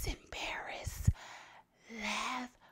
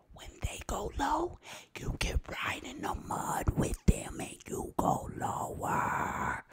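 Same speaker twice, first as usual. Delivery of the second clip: louder, slower, punching the correction; emphatic whisper-shout; 7 semitones higher, 6 dB louder, slower than usual. Shown as a demonstration. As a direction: push back hard on the saying. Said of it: "No, no, no, no".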